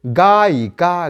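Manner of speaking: गायिका drawn out slowly, each syllable held; slow demo. Shown as a demonstration. Teacher showing students the word गायिका, slow and spaced. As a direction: stretch out गायिका for the class. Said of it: "फिर देखिए".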